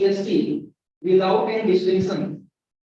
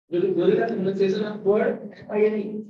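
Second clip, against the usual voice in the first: ordinary talk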